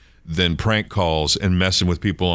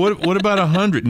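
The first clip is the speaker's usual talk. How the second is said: scammer voice; 12 semitones higher than usual. Retold or imitated. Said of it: "scammer voice again".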